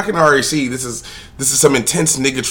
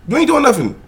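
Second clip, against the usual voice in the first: heated rant; 13 semitones higher than usual. Real, not acted. Escalating rant at man.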